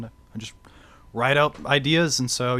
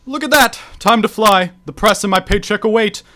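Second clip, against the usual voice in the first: pompous hero; 4 semitones higher, 6 dB louder than usual. Acted. Superhero bragging exit.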